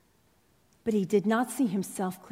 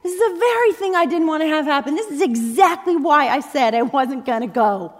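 mock furious rant, higher and louder; Jonah raging at God; 5 semitones higher, 6 dB louder than usual. Jonah railing at God for being too kind, hammed-up fury. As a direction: throw a hammed-up tantrum at God. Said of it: "Jonah rails at God for being awesome".

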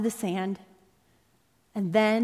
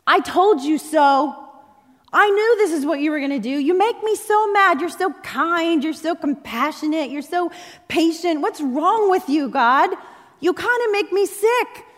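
mock furious rant, higher and louder; Jonah raging at God; 5 semitones higher, 6 dB louder than usual. Jonah railing at God for being too kind, hammed-up fury. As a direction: throw a hammed-up tantrum at God. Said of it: "Jonah rails at God for being awesome".

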